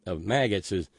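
normal talking voice

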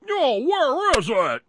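high, loud, slow strangled alien voice; alien bug voice. Retold or imitated. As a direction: squeeze it out like a bug wearing a man. Quoting the bug alien from the movie.

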